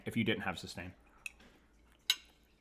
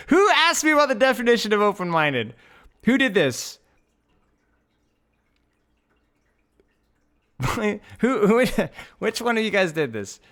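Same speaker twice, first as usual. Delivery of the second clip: mock outrage; 9 semitones higher, 6 dB louder than usual. Real, not acted. Mock outrage at a chat question.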